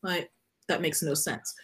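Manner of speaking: normal talking voice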